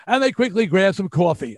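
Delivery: voiceover read slipping into New York "coffee"; New York accent. Retold or imitated. Show narrator catching himself in the voiceover booth, New York accent. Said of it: "my New York accent just jumped in there".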